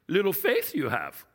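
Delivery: a gentle Yoda impression of Jesus. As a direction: say it like Yoda, calm and matter-of-fact. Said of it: "it reads like Yoda said it".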